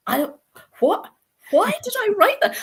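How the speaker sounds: high, loud startled disbelief; retold shock. Author stunned by her own written line, high startled squeal.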